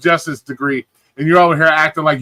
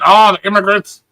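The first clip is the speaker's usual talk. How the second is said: hammed-up gripe, pitched up and louder; mock complaining; 3 semitones higher, 6 dB louder than usual. Streamer mimicking people who complain about immigrants, mock griping. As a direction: imitate a loud complainer. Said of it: "people are complaining".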